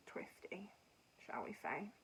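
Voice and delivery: a sly hushed twisty; coy whisper. Real, not acted. Coy word choice, whispered.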